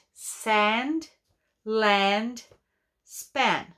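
"Sand, land, span" with a long open a, each word alone; teacher modeling words. Shown as a demonstration. Teacher models sand land span for pupils. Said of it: "teacher models rhyming words".

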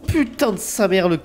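startled cursing. Real, not acted. Shot by a skeleton.

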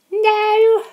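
loud, very high falsetto squeal; girly voice. Acted. Contestant denying being the nervous one, girly voice. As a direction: protest in a piercing girly falsetto. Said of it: "why did you do that girly voice".

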